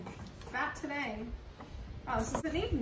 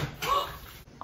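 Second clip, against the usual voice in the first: gasp. Real, not acted. Butter pops in microwave.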